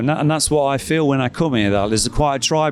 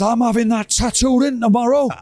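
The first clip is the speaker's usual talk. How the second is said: higher, proud announcement; a retold fan proudly vowing to get inked; 9 semitones higher than usual. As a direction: boast about tomorrow's tattoo. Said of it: "he goes".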